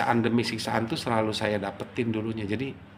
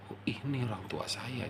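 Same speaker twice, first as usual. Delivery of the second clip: retold inner murmur, much softer; murmured to himself; 2 semitones higher, 9 dB quieter than usual. Son seeing his parent at last, murmuring inwardly. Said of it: "dalam hati saya tuh selalu bergumam".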